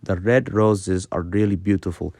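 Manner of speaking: tongue-tip R in "red roses really"; slightly rolled R. Shown as a demonstration. Teacher repeating the example, gently rolled "red roses". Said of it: "roll your R very slightly".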